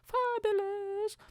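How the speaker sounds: high, slow camp fabulous; mock fabulous. Mocking the shoe slogan, camp and drawn-out.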